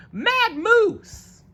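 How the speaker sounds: very high panicked squawk. Acted. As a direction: shriek the warning while fleeing. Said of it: "squawked Alligator".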